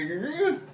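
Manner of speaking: high strained grunt; squashed groan. Retold or imitated. Fuller smashed between chair and wall, squashed groan. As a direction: groan like you are being squashed.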